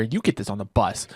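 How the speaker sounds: ordinary delivery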